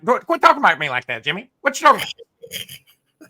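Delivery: mock-griping coach, climbing high at the end; Jimbo Fisher. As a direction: imitate a flustered coach, drawing out the last question. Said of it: "It is a low key Jimbo Fisher".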